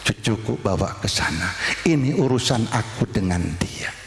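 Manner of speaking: the Prophet gently stopping his grandsons; soft calm. Acted. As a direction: quietly send the children away. Prophet sends grandsons away.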